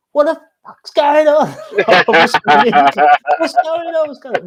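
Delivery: screaming at the frozen phone, half laughing. Retold or imitated. Driver screaming at frozen phone, high and laughing. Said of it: "proper screaming at it".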